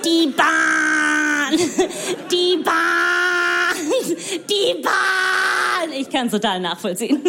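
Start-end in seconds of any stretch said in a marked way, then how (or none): 0.0-5.9 high, sustained moaning complaint; hammed-up droning grumble, high and stretched; mock grumbling Germans. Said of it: "Ihr meckert und meckert".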